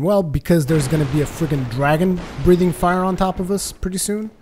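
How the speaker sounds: ordinary talk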